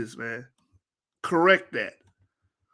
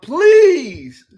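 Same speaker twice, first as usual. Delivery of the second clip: loud, high laughing plea; exasperated laughing outburst; laughing shout; 10 semitones higher, 11 dB louder than usual. Real, not acted. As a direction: cry out through a laugh. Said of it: "I'm sorry, y'all".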